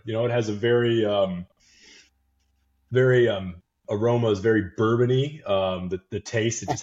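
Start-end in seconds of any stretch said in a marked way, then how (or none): none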